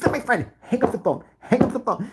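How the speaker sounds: high-pitched mock protest; protesting kid. Boy tells parents to hang up, high mock protest.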